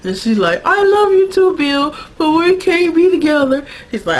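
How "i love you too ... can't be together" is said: high, wailing lovelorn imitation; mock boo-hoo. Sookie answers Bill in their breakup, mock boo-hoo. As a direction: wail it like a soap-opera breakup. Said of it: "they go into this boo-hoo".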